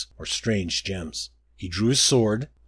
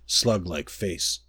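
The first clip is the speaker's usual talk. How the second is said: ordinary delivery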